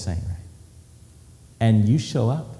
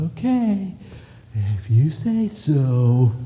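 condescending; much slower than usual. Acted. Patronizing Peter voiced.

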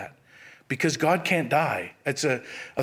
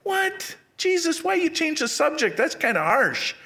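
sharply higher, incredulous what; a reader's puzzled protest, pitched up; mock surprise; 11 semitones higher than usual. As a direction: act baffled at a sudden question. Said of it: "I was like".

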